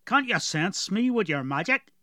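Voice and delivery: put-on gruff dwarf with a heavy rural accent; strong dwarf accent. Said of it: "Galoric had a very strong accent".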